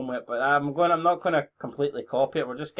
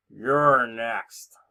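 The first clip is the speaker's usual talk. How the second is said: half-hearted attempt at a Russian accent; badly faked Russian accent, drawn out; [name]; 3 semitones lower, 4 dB louder than usual. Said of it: "That's supposed to be a Russian accent".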